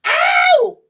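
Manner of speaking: high, loud yelp of pain; scream. A narrator's reading. Boy poked in the head, yelping loudly. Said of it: "he screamed so loudly".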